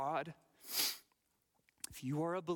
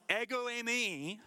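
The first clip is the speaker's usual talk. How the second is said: mild cry; 8 semitones higher, 3 dB louder than usual. Retold or imitated. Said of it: "by crying out".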